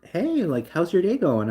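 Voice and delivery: high, chirpy mock cashier greeting; bubbly cashier. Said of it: "the cashier was literally like".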